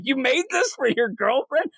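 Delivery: high-pitched, appalled question; incredulous. Acted. Robbie questions Paul about the device, incredulous. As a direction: question it in mock disbelief.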